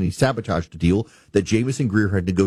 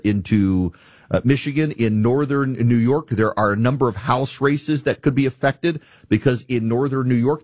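ordinary talk, nothing marked

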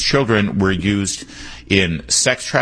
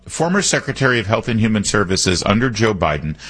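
ordinary delivery

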